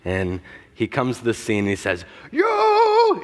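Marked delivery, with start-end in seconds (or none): 2.3-3.2 booming, high-pitched wizard's cry, drawn out; hammed-up imitation of a wizard defying a monster; Ian McKellen as Gandalf. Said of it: "in the Ian McKellen accent".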